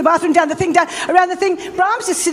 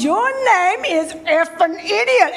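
slow, higher-pitched imitation of an angry caller; really angry; 6 semitones higher than usual. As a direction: draw out the mock insult crossly. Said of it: "he's really angry with me".

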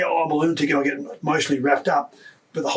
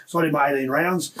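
usual speaking voice

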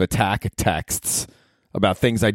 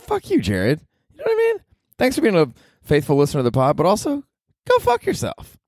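much higher squeaky outburst; voice shooting up; 10 semitones higher than usual. Real, not acted. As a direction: let the voice crack up high in mock rage. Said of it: "I'm not sure why my voice went up there".

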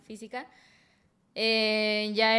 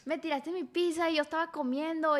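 otra vez el ex, agudo, quejándose de la pizza; novio quejumbroso; 6 semitones higher than usual. Retold or imitated. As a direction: lo remeda con burla ligera. Repite la imitación del novio. (el ex reclamando por su pizza, remedo agudo y burlón)

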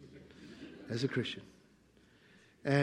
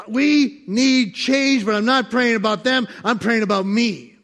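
raised, emphatic prayer voice; loud quoted prayer; 5 semitones higher, 8 dB louder, slower than usual. Retold or imitated. Preacher quoting a prayer, loud and emphatic. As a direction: call it out like a plea.

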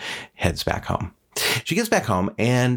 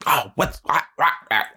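higher-pitched squawking outburst; hammed-up cartoon rant; mock yelling; 6 semitones higher than usual. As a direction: sputter wordlessly like an outraged witch. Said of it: "and she yells at the cat".

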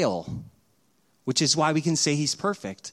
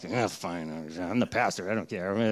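low, soft, grudging grumble; mock-sulky low mumble; 9 semitones lower, 6 dB quieter than usual. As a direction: give in sulkily, pitched way down. Grudging husband gives in.

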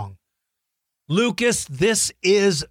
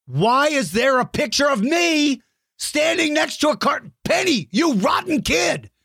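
high, hammed-up indignant shout; mock outrage; 9 semitones higher than usual. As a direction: pretend to be furious at the kid, playfully.

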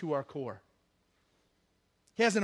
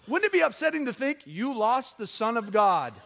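ordinary talk

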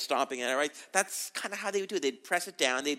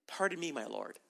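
softer, lowered, humble "Pardon me"; respectful and humble; 4 semitones lower, 8 dB quieter than usual. Acted. Gideon humbly answering the Lord, softer and meek. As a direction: say it meekly, backing down. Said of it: "He's getting a little more respectful now".

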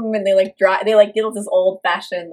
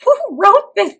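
exasperated; 12 semitones higher, 5 dB louder than usual. Real, not acted. Exasperated outburst at movie.

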